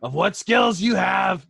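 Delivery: loud, high, emphatic self-defence. Acted. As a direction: push it out loudly over the others. Overheard whisperer defends himself loudly.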